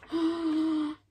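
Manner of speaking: long audible intake of breath; wide-eyed storytime gasp; suspenseful gasp. Pretend. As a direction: gasp as the surprise is revealed. Revealing what happens next.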